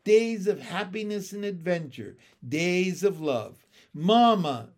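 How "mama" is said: put-on loud, raised childish call; insistent. A narrator's reading. Froglets in chorus, insistently calling their mother. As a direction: demand a mother's attention. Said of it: "came the insistent chorus of froglets".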